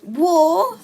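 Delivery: loud, high cry of alarm; shocked rising yell; screamed. A narrator's reading. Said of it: "I scream".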